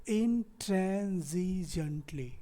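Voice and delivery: "intransigently" very slow, split as in-tran-zi-jent-ly; syllable-by-syllable demo. Shown as a demonstration. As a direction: stretch out each syllable of "intransigently" for learners. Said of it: "अब देखते हैं इसमें syllable कैसे हैं".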